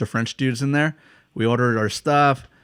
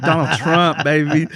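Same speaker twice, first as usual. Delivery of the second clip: hammed-up patriotic bragging, slightly raised pitch; boastful American tourist; 4 semitones higher, 4 dB louder than usual. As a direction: lightly mimic a trolling tourist. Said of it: "he'd go up to people and be like".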